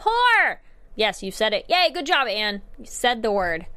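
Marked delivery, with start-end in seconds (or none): 0.0-0.6 a mock yell, much higher and louder; shout. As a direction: belt it out to get it out of your system. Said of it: "I almost want you to shout it".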